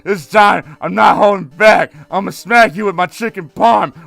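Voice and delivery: loud, hammed-up villain boasting; villain boss voice. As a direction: boom it out like a cartoon crime boss. Voicing Giovanni's boss speech.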